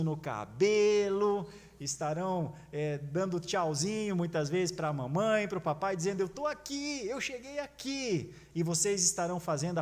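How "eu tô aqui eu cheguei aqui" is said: put-on higher, proud child's voice. Child at profession of faith calls to mom and dad, proud and higher. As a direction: call out proudly, a little slower. Grown child waving to parents.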